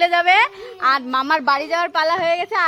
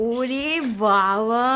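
drawn-out amazement; 6 semitones lower, much slower than usual. Real, not acted. Child offering rice.

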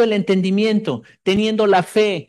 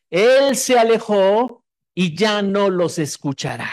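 solemn scripture reading; slower than usual. Acted.

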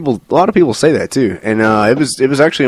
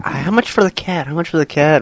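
high, squeaky mock caller; imitating an eager buyer; mock listener voice; 7 semitones higher than usual. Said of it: "Listeners be like".

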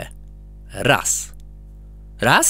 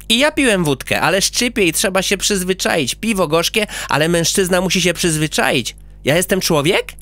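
put-on higher, eager little boy; proud child listing his nicknames; boastful little boy voice; boy character; 7 semitones higher than usual.

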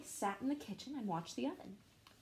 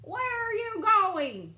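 slow loud call, much higher; shouted; 11 semitones higher, 7 dB louder, much slower than usual. Acted. Farmers shouting at the runaway, slow loud call. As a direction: bellow the question across the field. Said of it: "shouted the farmers".

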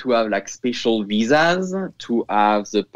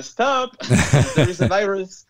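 mock telling the baby; 8 semitones higher than usual. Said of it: "tell the baby".

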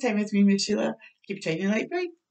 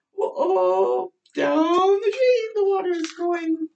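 excited whoa; 7 semitones higher, 5 dB louder, slower than usual. Acted. Water draining, excited exclaim.

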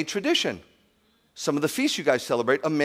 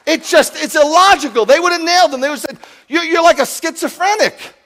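loud, high, fervent sermon delivery; preaching at full force; 9 semitones higher, 9 dB louder than usual. Acted. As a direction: preach it like the hall is packed and doubting. Preaching about Shaul and Shavuot.

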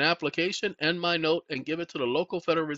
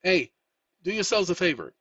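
normal talking voice

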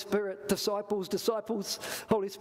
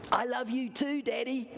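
put-on higher, smiling child voice; sweet little-girl voice, pitched up; giggly little girl; 6 semitones higher than usual. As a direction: answer Daddy as an adoring little daughter. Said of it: "little girl said back with a cute little smile".

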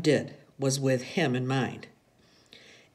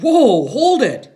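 high, loud, drawn-out halt; father's long, loud command; ordering shout; 10 semitones higher, 13 dB louder, slower than usual. A narrator's reading. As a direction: holler to halt the driver. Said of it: "his father ordered".

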